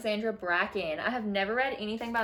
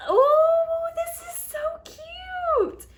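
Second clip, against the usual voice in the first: excited squeal; 8 dB louder, much slower than usual. Real, not acted. Unboxing a cute item.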